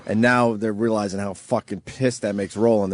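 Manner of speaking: normal talking voice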